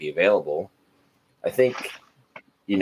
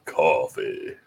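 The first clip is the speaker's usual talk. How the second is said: smooth sultry voice; 2 semitones lower, much slower than usual. Acted. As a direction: purr it like a coffee ad. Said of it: "You gotta do it in, like, a smooth, sultry voice".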